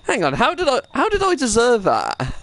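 incredulous, pitched high; stunned gamer protesting his death; high disbelief. Real, not acted. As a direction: voice jumps up in outrage. Outraged at getting killed.

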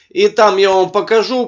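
ordinary talk, nothing marked

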